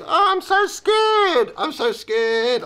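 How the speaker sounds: very high, slow mock-frightened whimper; mock scared. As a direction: ham up the coward, stretching each word.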